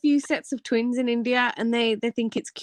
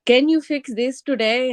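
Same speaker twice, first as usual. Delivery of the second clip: higher, imitated Indian accent; 5 semitones higher than usual. Coworker relaying a request to Dimple, Indian accent. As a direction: mimic an Indian developer's English. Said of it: "says it back in an Indian accent".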